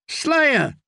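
high, falling tipsy hail; drunken call. A narrator's reading. Singer hails his friend, drunken high call. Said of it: "the singer called out drunkenly".

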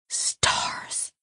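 breathless unvoiced whisper; exasperated whisper; whispered curse. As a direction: let it slip out under the breath. Pepper overwhelmed, pinching nose.

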